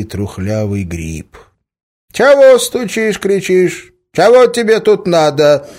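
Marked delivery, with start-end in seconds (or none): 2.1-5.7 put-on high, loud old peasant with stretched words; pitched-up, suspicious old man's holler; suspicious old villager. A narrator's reading. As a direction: challenge a stranger as a wary village grandpa. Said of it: "подозрительно спросил он".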